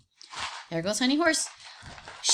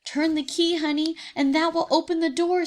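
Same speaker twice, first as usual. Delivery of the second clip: higher, urgent tiger plea; pushing Honey to act fast; eager tiger; 6 semitones higher, 4 dB louder than usual. Acted. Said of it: "said Timmy as he shook the bars".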